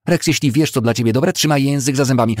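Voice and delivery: high, loud angry warning; threatening. A narrator's reading. Woman raising a fist at the boy, loud threat. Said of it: "wzniosła zaciśniętą groźnie pięść".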